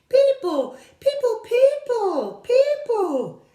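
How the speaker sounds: very high, loud shrill shrieks; screaming creatures. Acted. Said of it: "as loud as they could".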